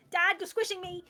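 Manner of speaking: put-on high, loud kid's complaint; protesting child. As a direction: squeal it like a squashed, wriggling child. Squirming child protesting a hug.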